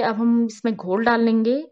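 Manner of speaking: normal talking voice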